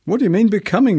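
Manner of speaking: high, loud mock-indignant cry. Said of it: "she cried".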